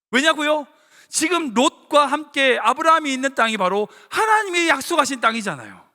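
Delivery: usual speaking voice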